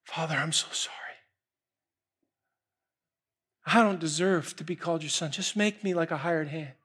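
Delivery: whisper-near, broken sorry; tender quiet plea. Acted. Son apologizing in his father's arms, softly. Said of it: "the son begins to speak".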